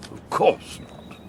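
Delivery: breathy unvoiced mocking echo; mock whisper. Echoing his colleague's denial, a sly mock whisper. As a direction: whisper it back with sly irony.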